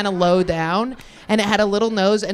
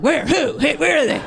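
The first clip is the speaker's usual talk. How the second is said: eager search; 7 semitones higher than usual. Retold or imitated. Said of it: "And I'm like".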